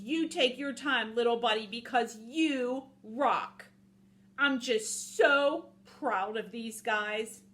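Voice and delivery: sincere storybook cheerleader; proud, trusting wolf. Acted. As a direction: stretch out the praise for a buddy.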